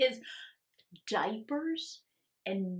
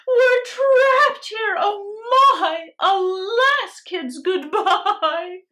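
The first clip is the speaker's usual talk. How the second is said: high, loud, slow crying wail; panicked scream; 9 semitones higher, 12 dB louder, much slower than usual. Acted. Teacher trapped on the island with her class, panicked crying wail. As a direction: wail in terror, stranded. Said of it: "started to scream".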